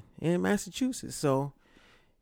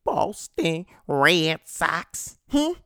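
slow, drawn-out mock gloat; teasing rival fans, slowly; 2 semitones higher, 3 dB louder, slower than usual. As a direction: rub it in to the Red Sox fans. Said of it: "I had to gloat a little bit".

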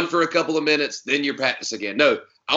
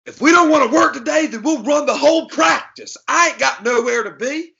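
high, loud Southern coach bark; Southern accent, way up high and loud, slowing on the practice line; 13 semitones higher, 6 dB louder than usual. Retold or imitated. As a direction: yell it like a Southern football coach. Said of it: "In that exact accent".

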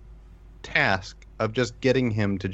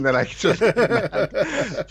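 real laugh breaking into the words; higher, talk through helpless laughter; laughing while trying to finish; 11 semitones higher, slower than usual. Real, not acted. Laughs recalling his in-game defeat.

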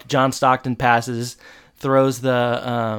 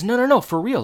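high, louder frantic protest; mock frantic refusal; 11 semitones higher, 4 dB louder, faster than usual. Corey refuses Mary Beth's advances, high, loud and frantic. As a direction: blurt the refusal in a rush of panic.

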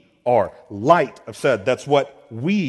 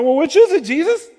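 much higher and louder, mock-exasperated; exasperated questioner; 13 semitones higher, 7 dB louder than usual. Preacher voicing his own confused question to Jesus, mock exasperated. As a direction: throw up your hands at Jesus in mock confusion. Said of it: "I'm like".

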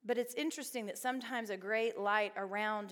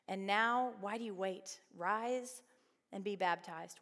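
ordinary talk

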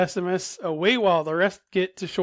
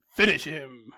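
booming fighting game announcer; 4 semitones lower, 3 dB quieter than usual. Pretend. Mortal Kombat style call.

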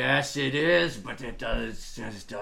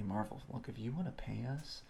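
much softer confidential pitch to Marvel; hushed aside; 9 dB quieter than usual. Acted. Host quietly pitching ads to Marvel, hushed. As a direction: lean in and murmur it privately.

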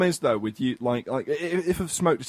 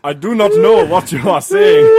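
loud, high put-on warlord voice; Kony voice; 5 semitones higher, 11 dB louder than usual. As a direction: shout it as a stiff warlord. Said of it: "can I stop doing the Kony voice".

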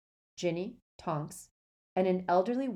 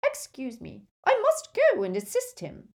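put-on high, brisk elderly witch; stern old lady; 8 semitones higher, 7 dB louder than usual. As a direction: speak like a formidable grandmother. Voices Mrs Longbottom.

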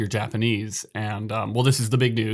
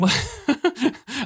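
sudden high-pitched laugh; high burst of laughter. Real, not acted. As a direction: laugh out, caught off guard. Reacts to teasing question.

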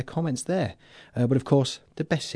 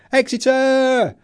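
"Exeter!" shouted high and slow, stretched out; exclaimed with exclamation marks; 13 semitones higher, 8 dB louder, much slower than usual. Retold or imitated. Reading a listener's one-word message as a loud, slow, high exclamation. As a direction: belt out "Exeter!" like a listener's cheer. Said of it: "with exclamation marks".

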